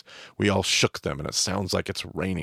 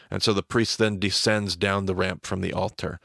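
ordinary talk, nothing marked

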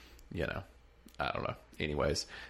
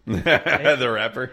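laughing; 6 semitones higher, 8 dB louder, much slower than usual. Real, not acted. Laughing at the rapper joke.

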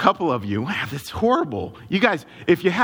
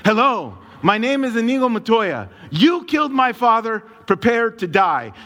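higher-pitched mock Latin accent; hammed-up Latin accent, pitched up; Inigo Montoya; 4 semitones higher than usual. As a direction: declaim the avenger's vow in a Latin accent. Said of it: "that's my best Latin accent".

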